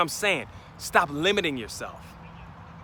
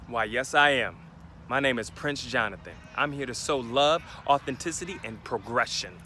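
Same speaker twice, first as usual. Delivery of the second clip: lower, softer, grand and composed; proud self-introduction; 5 semitones lower, 6 dB quieter than usual. Shown as a demonstration. Speaker shows how to introduce yourself, lower and proudly composed. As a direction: announce yourself with calm pride. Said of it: "You gotta say it like that".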